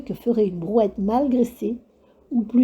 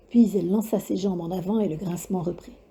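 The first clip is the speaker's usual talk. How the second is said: plain reading voice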